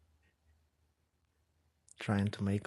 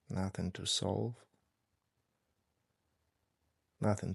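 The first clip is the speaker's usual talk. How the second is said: guided meditation. Real, not acted. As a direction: ease them gently into stillness, unhurried. Leads a breathing meditation.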